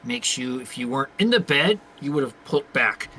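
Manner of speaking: normal talking voice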